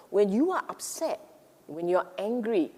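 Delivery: ordinary delivery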